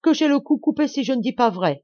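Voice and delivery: high, loud protest of truth; insistent. A narrator's reading. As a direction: swear it to a furious master. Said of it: "insista-t-il".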